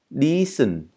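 teacher models "Decent" with a long ee. Shown as a demonstration. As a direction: stretch the ee in "Decent". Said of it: "thậm chí đây là y dài cơ".

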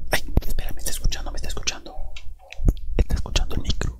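urgent hushed whisper. Real, not acted. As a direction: whisper so nobody hears. Hiding, afraid of being heard.

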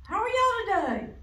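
put-on bright, high greeting to children; cheery storytime greeting. Cheerful teacher greeting class.

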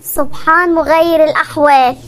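low, slow wry aside; wry remark. Acted. Husband comments wryly on his wife's change of mood. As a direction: mutter it dryly, amused.

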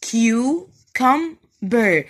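very slow, loud CU - CUM - BER for "cucumber"; teacher exaggerating the syllables of "cucumber"; three separate syllables. Shown as a demonstration. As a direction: stretch each beat of "cucumber" with gaps between. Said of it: "بنطقهم على ثلاث مراحل".

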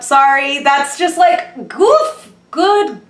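high, loud, drawn-out amazement; excited. Real, not acted. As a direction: blurt it out, thrilled and astonished. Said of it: "I'm just excited".

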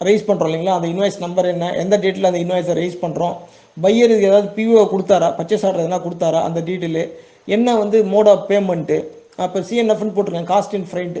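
usual speaking voice

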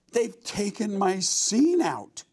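a hammed-up howl over the cut scene; mock anguished yell. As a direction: play the upset actor crying out, stretched slow. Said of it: "he is really screaming".

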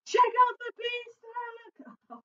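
excited. Acted. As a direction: show off the giant bean stack. Jack excited about beanstalk.

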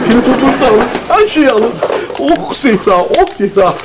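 ordinary delivery

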